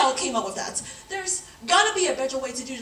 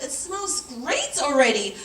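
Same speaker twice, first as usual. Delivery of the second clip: ordinary talk, nothing marked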